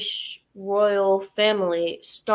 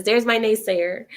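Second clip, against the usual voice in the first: ordinary delivery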